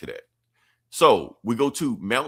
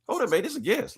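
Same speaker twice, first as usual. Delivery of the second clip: much higher, lightly voiced protest; mock protest; 14 semitones higher, faster than usual. Teammate defending Static from Cyborg, high mock protest. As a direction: put up your hands and pitch it high.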